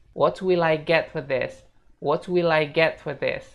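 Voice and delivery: "what will I get for this" slow, "will I" as wi-lai; slow it down for learners, linking "will I"; careful model "what will I get for this"; linked model phrase. Shown as a demonstration. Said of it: "Jadinya bakal kayak gini".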